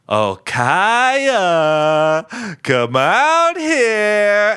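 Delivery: high, drawn-out mock villain call; creepy sing-song calling. Imitating a villain luring Kaya out of hiding, sing-song calling. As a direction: stretch each word in a taunting, eerie lilt. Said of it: "when has anyone ever talked like that".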